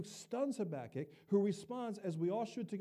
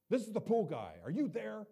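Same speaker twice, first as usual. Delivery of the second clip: curt, a bit louder phone voice; gruff pool guy; 3 semitones higher, 5 dB louder than usual. Retold or imitated. Gruff pool guy calling the pastor, curt and a bit louder. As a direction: bark it lightly down the phone. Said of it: "he's pretty gruff".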